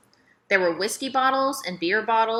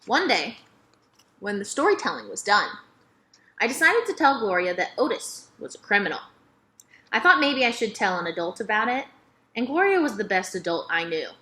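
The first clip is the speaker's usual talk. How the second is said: ordinary delivery, nothing marked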